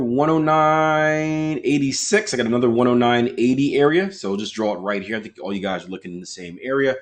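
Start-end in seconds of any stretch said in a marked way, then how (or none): 0.0-4.1 auctioneer call. Real, not acted. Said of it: "I sound like an auctioneer".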